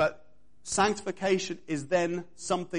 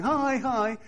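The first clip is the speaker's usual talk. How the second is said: higher, gushing mock hello; fake friendly hi; 7 semitones higher, 3 dB louder than usual. Coworkers greet each other, sugary and higher. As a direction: chirp a false hello. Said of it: "they were all going".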